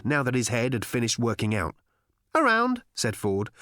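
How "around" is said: high, airy evasion; infuriatingly breezy. A narrator's reading. Ford dodges Arthur's question, high and infuriatingly breezy. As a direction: toss it off lightly, pitched high. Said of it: "judged to be an infuriating manner".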